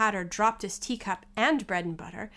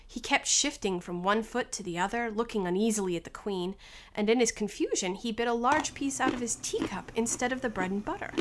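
ordinary delivery, nothing marked

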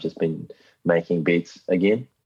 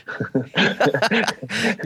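sudden high-pitched laugh; high burst of laughter; 13 semitones higher than usual. Real, not acted. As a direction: burst out laughing at your own joke. Laughs at own covid joke.

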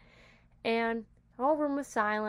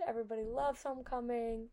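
normal talking voice

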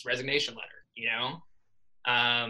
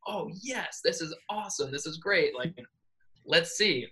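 higher, hushed fake excitement; mock enthusiasm; 5 semitones higher than usual. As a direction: play it as eager but faking it. Feigning excitement over the envelope.

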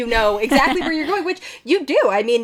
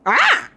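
short high, loud "Ah!"; a startled little creature cry; sudden creature yelp; 5 dB louder than usual. Acted. Creature reacts after reading tattoo.

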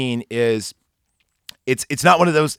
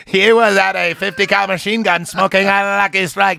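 chipper 1950s ad; 6 semitones higher than usual. Pretend. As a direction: hype it like an old radio ad. Mock cigarette ad voice.

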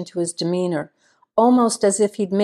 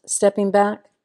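ordinary delivery

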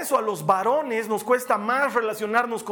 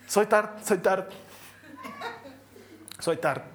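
acted stutter with long blocks; stammering Moisés; 4 dB quieter than usual. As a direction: get stuck mid-word, restart after pauses. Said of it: "soy tartamudo". (tartamudeo fingido, atascado a media palabra)

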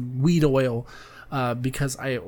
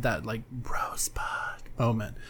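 whispered; 5 semitones lower, 9 dB quieter than usual. Real, not acted. Dreamy aside.

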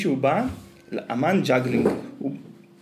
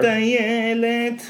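mock drawl; 10 semitones higher, 4 dB louder, much slower than usual. Shown as a demonstration. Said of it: "אתה בטיילת".

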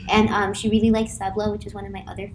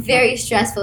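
ordinary delivery

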